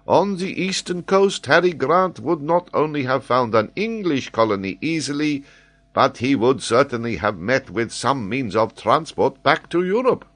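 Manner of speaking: an excitable scholar holding forth; high, animated geographer explaining; Paganel, eager learned Frenchman. Acted.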